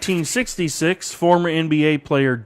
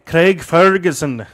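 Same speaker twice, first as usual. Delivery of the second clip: slow, louder mock Scottish accent; bad Scottish accent; 3 semitones higher, 5 dB louder, slower than usual. Host announcing a Scottish TV host, bad Scottish accent. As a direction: make a poor stab at a Scottish accent. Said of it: "That's a horrible accent".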